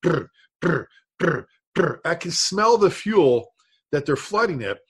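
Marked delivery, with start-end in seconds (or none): 0.0-2.0 short buzzing saw sputters; imitated saw starting. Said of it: "they got their saw, and they're going".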